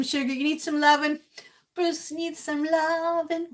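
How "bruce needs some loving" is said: cooing. Real, not acted. Cooing to Bruce.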